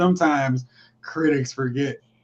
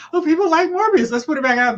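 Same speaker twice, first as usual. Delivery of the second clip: high, loud, gullible excitement; put-on eager bright idea; mock eager studio; 11 semitones higher, 6 dB louder than usual. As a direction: pitch it like a clueless executive. Acts out clueless studio's reaction.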